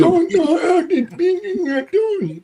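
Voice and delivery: high-pitched mock Italian babble; fake Italian. Pretending not to speak English to police, fake Italian. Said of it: "Just stop speaking Italian".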